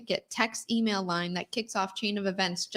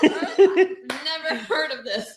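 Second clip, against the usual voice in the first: laughing; 5 semitones higher, 12 dB louder, much slower than usual. Real, not acted. Laughing at unfamiliar jargon.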